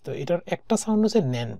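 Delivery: ordinary talk, nothing marked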